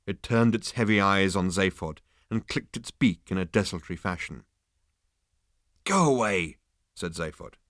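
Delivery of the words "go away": dismissive call. A narrator's reading. Shooing off a strange bird.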